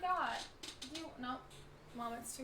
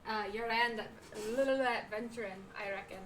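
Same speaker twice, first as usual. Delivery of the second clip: halting read in a pirate brogue; put-on, inconsistent pirate accent; bad pirate accent; 4 dB louder than usual. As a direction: play a pirate innkeeper, accent wobbling. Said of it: "Incoming bad accent".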